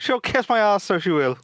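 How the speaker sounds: mock Irish voice; Irish accent. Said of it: "She's not even Irish".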